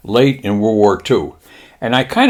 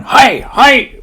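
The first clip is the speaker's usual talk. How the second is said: high, loud mock-Japanese chorus; Japanese pilots' shout; 5 dB louder than usual. Pilots answering their admiral, high mock-Japanese shout. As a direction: shout it like obedient recruits. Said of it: "all these guys are going".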